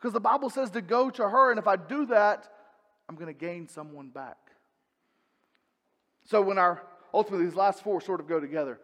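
usual speaking voice

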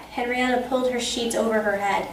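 ordinary delivery

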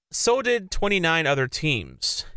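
normal talking voice

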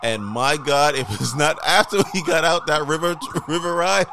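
ordinary talk, nothing marked

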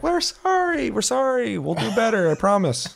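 high, whiny pleading apology; mock apology. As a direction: beg forgiveness in a squeaky put-on voice. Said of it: "they're like".

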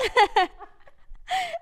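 high burst of laughter; loud laugh. Real, not acted. Laughing at friend's habit.

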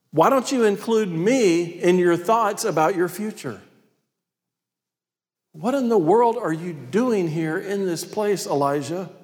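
high, insistent reproof; God. Acted. God reproving Elijah for forgetting him, insistent. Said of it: "then God asks him the question".

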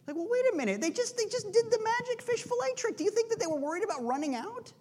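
very high, squeaky disbelief; acted incredulity. Preacher answers people worried about waste, acted incredulity. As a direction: protest in mock astonishment, voice shooting way up.

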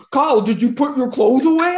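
ordinary talk, nothing marked

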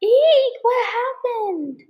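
high startled squeal then slow; startled shriek. Acted. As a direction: shriek in alarm. Friend just yelped in pain.

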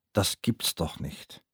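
slow, soft and numb; stunned disbelief. Retold or imitated. Narrator reeling after the HIV news, slow soft disbelief. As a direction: murmur it as if it cannot be true.

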